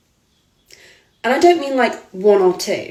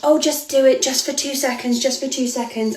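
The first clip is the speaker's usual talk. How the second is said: mocking the photographer; 5 semitones higher than usual. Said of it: "He just kept saying to me".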